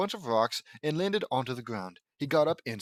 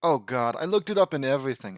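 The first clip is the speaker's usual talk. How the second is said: ordinary talk, nothing marked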